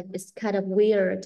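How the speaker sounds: slow, with kind of run together; slow model reading; slow linked reading. Shown as a demonstration. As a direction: read It's kind of weird slowly and linked. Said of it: "Thì mình đọc là".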